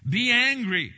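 loud, high proclamation; preacher shouting Be angry; booming command. Acted. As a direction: thunder out the command.

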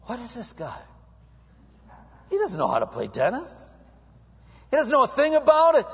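rising to high, fast disbelief; mock-astonished dismissal; baffled onlookers. Onlookers baffled by the player's clumsy swing. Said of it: "They're looking at me going".

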